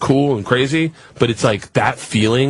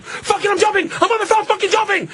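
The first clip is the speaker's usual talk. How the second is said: much higher, wild do-or-die blurt; losing it on the ledge, pitched way up; reckless outburst. Retold or imitated. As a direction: throw caution away and shout it out. Snaps and decides to jump.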